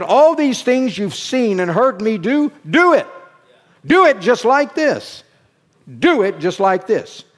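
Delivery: loud, high, urgent charge; Jesus commanding. Retold or imitated. Preacher quoting Jesus's charge to do likewise, loud command. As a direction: hammer out the command to followers. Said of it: "That's how Jesus talked".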